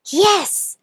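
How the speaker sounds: a little mouse making up his mind; determined mouse voice. Acted. Mouse resolves to roar.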